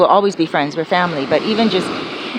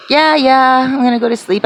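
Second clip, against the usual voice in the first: high, louder breezy yeah yeah; brushing him off; 6 semitones higher, 3 dB louder than usual. Retold or imitated. Brushing off her friend's question about bed, breezy and high. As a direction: shrug him off lightly. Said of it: "I was like".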